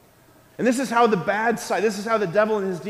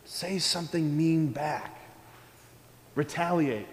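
soft, slow, low tempting murmur; a hushed, sly devil voice; devil's whisper; 4 semitones lower, 6 dB quieter than usual. Acted. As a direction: lean in like the devil tempting you. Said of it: "The devil's gonna whisper".

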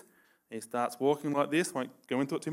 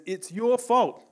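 raised, louder mock blame; accusing quote; 7 semitones higher, 6 dB louder, slower than usual. Preacher voices Adam blaming God, accusing. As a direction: act out Adam pinning the blame, voice jumping up.

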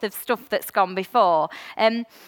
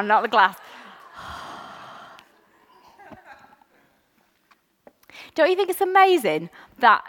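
ordinary talk

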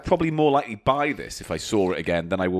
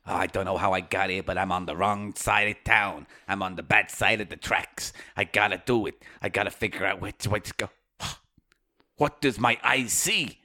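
lost Brit on the wrong side of town, hushed; quietly lost streetwise guy; streetwise guy out of his depth; 5 semitones lower, 4 dB quieter than usual. Pretend. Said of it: "doing a British accent".

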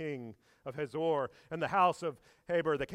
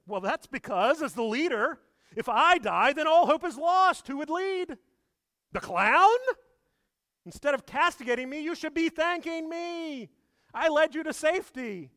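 much higher, loud self-justifying voice; George defending himself; 9 semitones higher, 7 dB louder than usual. Retold or imitated. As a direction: insist you were the hero, indignant. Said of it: "And then he says".

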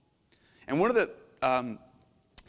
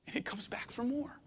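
softer, whispered and awed; hushed whisper; 6 semitones higher, 9 dB quieter than usual. Real, not acted. Pastor awed by Jesus returning, whispered. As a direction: lean in and breathe it. Sermon climax about Jesus.

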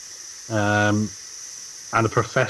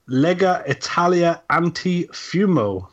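slow, higher Italian accent; 6 semitones higher, slower than usual. Shown as a demonstration. Host reading the league's name from the news, Italian accent. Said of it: "Great Italian accent, by the way".

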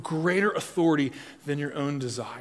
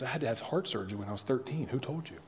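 hushed secret; 7 dB quieter, faster than usual. Retold or imitated. Man in small group hushes, confessing to pastor, dropped to a soft hush. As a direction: confide it softly, like a secret nobody should hear. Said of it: "He goes".

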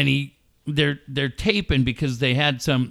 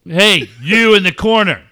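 loud, raised mock call-out; Ric Flair; 4 semitones higher, 6 dB louder than usual. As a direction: imitate a wrestler singling someone out loudly. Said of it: "He points at her".

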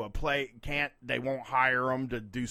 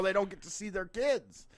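ordinary talk, nothing marked

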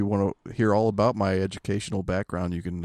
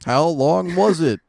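high, loud, drawn-out shout; slow, loud mock heckle; audience call-back; 9 semitones higher, 7 dB louder, slower than usual. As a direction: yell it like a game-show crowd. Audience-style heckle of the joke.

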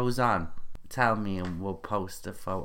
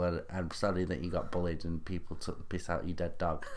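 normal talking voice

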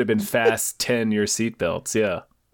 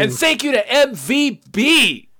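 loud, high shout-out read; hyped announcer; 9 semitones higher, 5 dB louder than usual. Acted. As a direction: announce each name like a hype man. Hyped roll call of producers.

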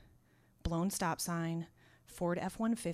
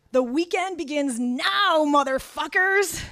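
slow, loud, high bar announcement; a loud, slow party cry; 8 semitones higher, 10 dB louder, slower than usual. Retold or imitated. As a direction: announce it to the whole bar, drawn out. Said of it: "he announces to the bar".

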